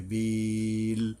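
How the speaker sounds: "বিল" with a very long held i; slow chanted "বিল"; long madd stop. Shown as a demonstration. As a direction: stretch "বিল" on a level tone, then stop. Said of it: "তিন আলিফ টানে থামতে হবে".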